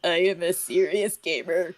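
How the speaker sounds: smug nasal pedant, lightly voiced; a hint of smug gamer; neckbeard voice. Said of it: "neckbeard voice".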